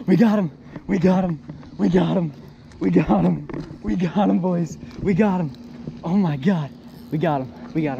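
high, loud cheer of triumph; excited yelling. Real, not acted. Celebrating a hooked fish.

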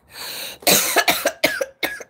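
harsh smoker's cough after a hit; coughing fit. Real, not acted. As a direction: hack and cough hard.

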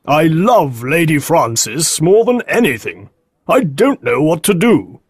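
mildly anguished man's voice; Green, distressed. A narrator's reading. Green confessing his love to Holmes, mildly distraught. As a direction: confess love with a touch of helplessness. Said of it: "Green cried".

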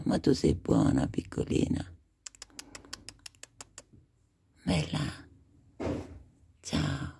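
high sing-song cooing at a kitten; sweet little-voice coaxing. Real, not acted. Cooing to a pet cat.

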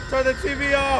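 high, loud, panicked order; urgent shout. Real, not acted. Player desperate to get the TV off, yelling high. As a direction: yell it at full urgency. Shouting as game threat nears.

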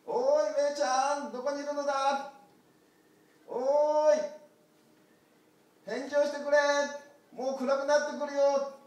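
high, loud calling across the hills. Acted. Shepherd calling loudly for the lost lamb. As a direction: shout out to find the lost lamb.

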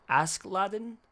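"Askeladdin" said with a careful, foreign-sounding attempt; Norwegian word attempt. Shown as a demonstration. Explaining the film's title term, careful foreign pronunciation. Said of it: "I'm gonna butcher this".

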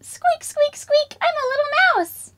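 very high tiny mouse voice; high squeaky voice of a little mouse. Acted. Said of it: "What does a mouse say".

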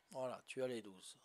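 ordinary talk, nothing marked

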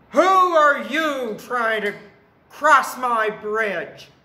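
booming, high-pitched troll demand; challenging troll. A narrator's reading. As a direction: confront the big goat at full volume.

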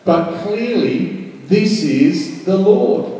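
normal talking voice

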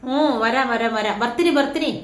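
pet-talk to the cat, calling from another room; coaxing the cat. Real, not acted. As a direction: reassure the pet you're coming. Answering her crying cat.

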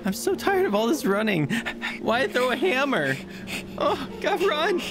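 high frantic running voice; terrified, out of breath; panicked fleeing. Acted. Fleeing a thrown hammer.

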